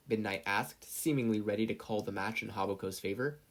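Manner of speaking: ordinary delivery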